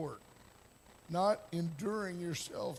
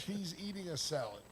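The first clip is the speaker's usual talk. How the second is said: usual speaking voice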